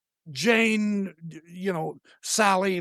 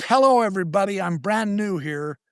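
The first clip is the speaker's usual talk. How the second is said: retold dictation; 5 semitones higher, 6 dB louder than usual. Friend dictates what he should type.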